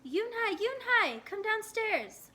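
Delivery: high, loud mother's call; calling up the stairs. A narrator's reading. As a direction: call her down from below. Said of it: "Mother called up to Yoon-hae".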